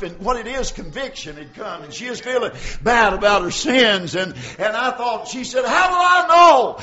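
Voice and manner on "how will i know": voicing granddaughter. Granddaughter voiced in retold story.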